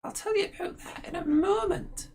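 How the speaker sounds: put-on high old hag approving; crone voice. Ethel praises her sister's plan, high crone voice.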